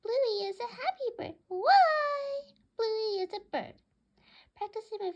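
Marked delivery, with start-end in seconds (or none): 0.0-3.7 high, loud talking bird voice; piercing bird chatter, held long on the cry; squawking pet bird. Acted. Narrator voices Bluey the bird squawking.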